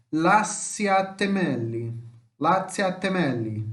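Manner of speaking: slow, drawn-out Italian example word "Lasciatemeli"; careful demonstration. Shown as a demonstration. Teacher showing students where the stress falls, careful demonstration. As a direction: sound out "Lasciatemeli" slowly, syllable by syllable. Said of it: "pra você acertar a sílaba tônica".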